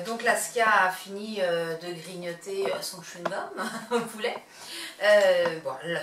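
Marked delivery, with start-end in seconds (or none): 1.4-4.4 hushed whisper not to disturb the dog. Real, not acted. Vlogger whispering beside the chewing puppy. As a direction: whisper it with a small laugh.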